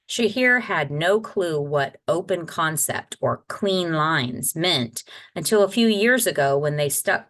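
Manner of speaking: plain reading voice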